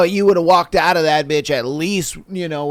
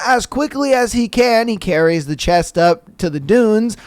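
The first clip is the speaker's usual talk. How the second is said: normal talking voice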